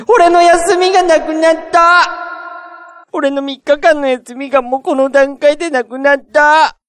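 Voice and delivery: high, loud despairing cry; anguished wail. Real, not acted. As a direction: wail it like all is lost. Lamenting lost days off.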